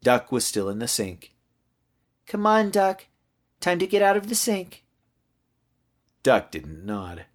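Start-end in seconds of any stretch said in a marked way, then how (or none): 2.3-4.8 put-on high child's voice, coaxing; high-pitched little-kid prompting; little girl. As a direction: urge a toy along like a small kid. Girl coaxing her toy.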